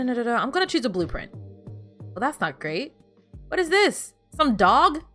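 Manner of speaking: usual speaking voice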